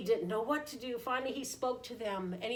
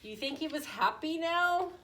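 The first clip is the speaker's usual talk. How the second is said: ordinary delivery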